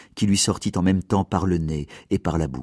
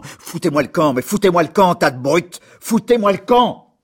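loud, much higher furious yelling, building; 11 semitones higher, 5 dB louder than usual. Acted. Mayor chasing the gawking villagers away, furious shouting. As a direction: drive the crowd off with rising rage. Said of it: "devint furieux ... en balbutiant".

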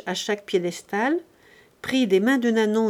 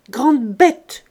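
loud, higher scolding bark; scolding shout; 4 semitones higher, 9 dB louder than usual. Acted. Master yelling at his stumbling servant, loud scolding. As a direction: shout her down as a clumsy fool.

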